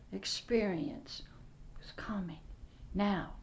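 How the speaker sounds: breathy hushed testimony; hushed whisper. Real, not acted. Preacher whispering his testimony to the congregation. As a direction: whisper it like a sacred secret.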